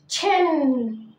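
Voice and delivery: تشين stretched out very slowly for learners; تشين said slowly and clearly for students; slow repeat-after-me. Shown as a demonstration. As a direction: draw out تشين slowly so they can copy it. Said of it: "قول ورايا".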